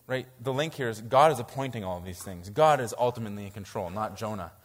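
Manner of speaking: ordinary delivery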